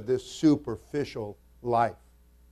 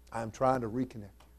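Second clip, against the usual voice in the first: quiet aside; 2 semitones lower, 10 dB quieter, faster than usual. Real, not acted. Preacher confiding his aim to the congregation, quiet quick aside. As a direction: drop the volume and say it quickly. Softer repeat after emphatic plea.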